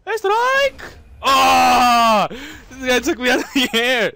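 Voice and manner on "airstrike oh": loud high call of airstrike; excited shout. Real, not acted. Calling an airstrike mid-match, a loud excited shout.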